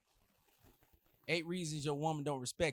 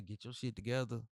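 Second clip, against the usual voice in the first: imitating a fed-up girlfriend; 4 semitones lower, 6 dB quieter than usual. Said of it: "That's what they gonna say".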